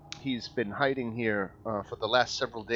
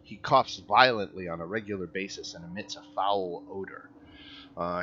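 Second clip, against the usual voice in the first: normal talking voice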